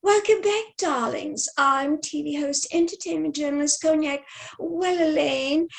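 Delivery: put-on British TV host voice; British accent. Shown as a demonstration. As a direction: lay on a very British accent, drawing out the name. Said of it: "do a very British accent".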